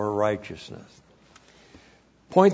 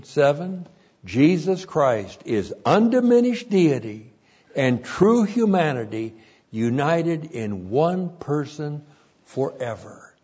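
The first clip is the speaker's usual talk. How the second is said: ordinary talk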